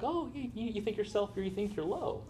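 high, hushed mock-humble voice. Preacher imitating the false humility of pride, mock humble. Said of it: "It sounds almost humble".

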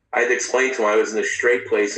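ordinary delivery

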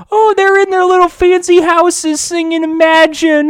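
high, loud mock celebrity whine; mocking late night hosts. Host mocks celebrities singing in their fancy houses, high mock whine. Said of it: "all these fucking late night hosts".

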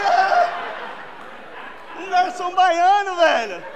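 shouted, drawn-out realization; loud exclaim. Retold or imitated. Telling the crowd about the Bahian waiter, loud and drawn out. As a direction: belt it out like a punchline.

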